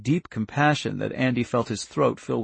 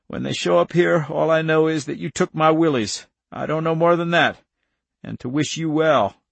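slightly raised, steady farmer's voice; resolute countryman; 5 semitones higher, 5 dB louder than usual. Acted. Irv promises Andy his silence, resolute. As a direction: state it firmly, a friend's promise.

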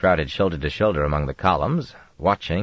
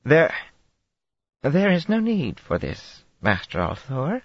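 put-on Tarabana accent, slightly unsteady; 10 semitones higher than usual. A narrator's reading. Aes Sedai calming the Dragon, Tarabana accent slightly unsteady. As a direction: soothe Rand in Tarabana accent, nerves showing. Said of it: "voice became slightly unsteady".

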